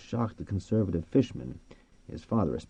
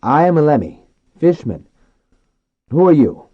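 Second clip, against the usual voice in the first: higher, louder man calling to dolphins; man addressing dolphins; 8 semitones higher, 11 dB louder than usual. A narrator's reading.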